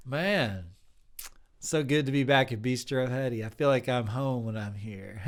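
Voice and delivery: loud, drawling sugar daddy who calls everyone hun; sleazy sugar daddy. Acted. Improv sleazy banjo-loving sugar daddy charming the bistro staff. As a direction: swagger in like you own the bistro, hun.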